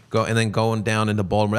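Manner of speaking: ordinary talk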